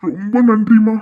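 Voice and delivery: choked, tearful thank you; thanks him through tears. Acted. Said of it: "ஏய், என்ன அழாதீங்க?". (கண்ணீருடன் நன்றி)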